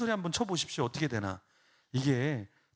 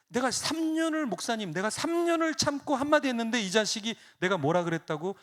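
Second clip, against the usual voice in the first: exasperated father; 6 semitones higher, 3 dB louder than usual. Retold or imitated. Father voiced complaining to pastor.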